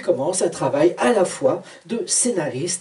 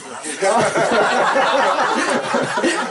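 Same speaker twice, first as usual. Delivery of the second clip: hearty laugh; 4 dB louder than usual. Real, not acted. Host laughing at a joke.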